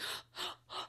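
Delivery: imitated dramatic gasp; airy mock gasp of disbelief; shocked gasp. Woman seeing Jared at the party, shocked gasp. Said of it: "where she was like".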